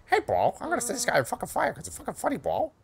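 Francis impression. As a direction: put on a squeaky, pushed bro voice. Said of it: "He sounds like".

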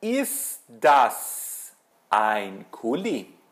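very slow, each word separated; deliberate teacher's question for learners; model question. Shown as a demonstration. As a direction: ask it word by word, swooping up then down on "Kuli". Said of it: "Eu vou perguntar".

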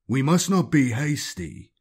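slow and measured, holding them back; lightly voiced grave librarian; cautious warning. Librarian stopping the execution of prisoners, slow caution.

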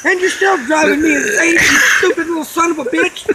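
mock angry father. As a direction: rant at the son like a fed-up father. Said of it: "he's just like".

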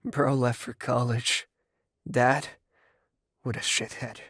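low, halting, sorrowful; heavy-hearted confession. Acted. Richie recalling freshman pain to partner, heavy-hearted.

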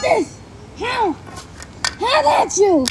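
loud strained call while striking; effort shout. Real, not acted. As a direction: shout as you swing. Swinging tool at car light.

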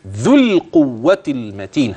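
"ذو القوة المتين" slowly, dh passing straight to the l; teacher's measured "ذو القوة المتين"; recite it like this. Shown as a demonstration. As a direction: model "ذو القوة المتين" carefully. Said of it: "هكذا".

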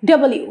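"ডব্লিউ" opened out as double-you; correct W. Shown as a demonstration. Teacher models correct W, "ডব্লিউ" as double-you. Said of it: "আমরা কিন্তু বলবো".

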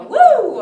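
high loud woo; woo sound effect. Shown as a demonstration. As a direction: whoop it up playfully. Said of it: "make some sort of noise".

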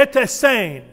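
Ete sane with Ete stressed and falling; informal Twi greeting. Shown as a demonstration. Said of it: "they would say".

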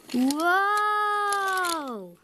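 long, high, drawn-out startled whoa; a cartoon yelp of alarm as the car lurches. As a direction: stretch out a wobbly alarmed whoa. Car swerving on the tracks.

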